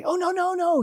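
high-pitched string of anxious protest; acted hasty reassurance. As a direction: rush in to head off tears. Acted man soothing crying girl.

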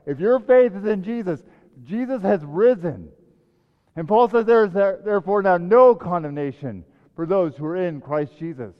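loud, high-pitched preaching build; fervent preaching. Acted. Pastor proclaiming scripture to the congregation, fervent preaching. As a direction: proclaim it to the whole room.

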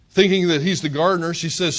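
ordinary talk

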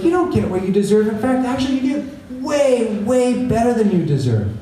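a hint of a joyful prophet, drawing out way; voicing Zephaniah. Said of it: "Zephaniah is like".

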